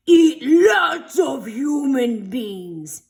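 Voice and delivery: very slow, loud monster growl. A narrator's reading. The monster grabbing the teacher, a slow loud growl. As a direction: growl it out like a hungry beast. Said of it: "he growled".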